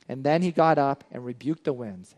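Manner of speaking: usual speaking voice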